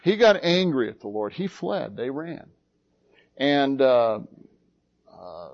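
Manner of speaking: ordinary talk, nothing marked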